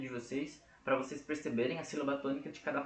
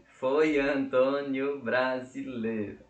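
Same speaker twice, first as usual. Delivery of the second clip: slow, louder, separated syllables; syllable-by-syllable counting; 6 dB louder, much slower than usual. Shown as a demonstration. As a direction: tap out each syllable of the line. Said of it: "tem sete sílabas poéticas".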